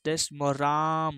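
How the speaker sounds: राम said very slowly, syllable by syllable; slow drawn-out. Shown as a demonstration. Teacher finishing the pluta example, very slow. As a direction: spell out the beats slowly, then land on राम. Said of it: "तो थोड़ा सा लंबा उच्चारण करेंगे".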